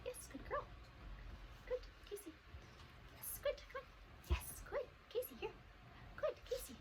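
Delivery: high, soft, half-whispered praise; soft dog praise. Real, not acted. Trainer coaxing Casey with high, soft, half-whispered praise.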